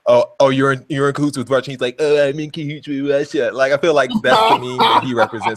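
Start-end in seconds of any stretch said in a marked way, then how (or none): 2.0-3.5 a lightly voiced dopey echo of the accusation; mocking dumb echo. Mimicking the SpongeBob meme, a hint of a dopey voice. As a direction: repeat it back sounding a bit stupid. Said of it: "makes you sound really stupid".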